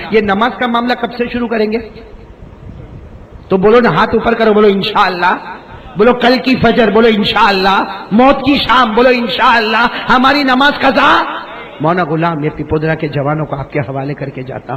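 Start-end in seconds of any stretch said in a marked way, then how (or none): 3.5-11.2 loud, high call-and-response; rousing preaching. Real, not acted. Preacher urging the youth to pledge prayer, loud. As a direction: whip the crowd into answering.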